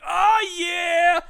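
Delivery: high, loud hooting chimp cheer; excited chimp. Retold or imitated. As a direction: shout it like a thrilled ape. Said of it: "He's like".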